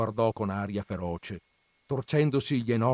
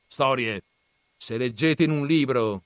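lightly voiced scornful old grandfather, raised; gruff grandfather; 4 semitones higher, 6 dB louder than usual. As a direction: scoff at the books' dreamers. Grandfather scoffs. (nonno burbero accennato, tono sprezzante)

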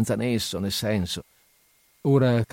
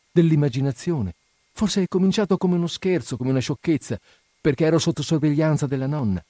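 higher, agitated confession that falters; choked with emotion; 6 semitones higher than usual. A narrator's reading. Nastenka doubting her love before her friend, emotional and faltering. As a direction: talk yourself into it, then break off. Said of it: "soffocata dall'emozione".

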